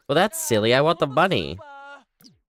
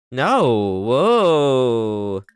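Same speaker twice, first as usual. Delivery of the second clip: loud, drawn-out exclamation; surprised outcry; 6 dB louder than usual. Real, not acted. Surprised by discovery in game.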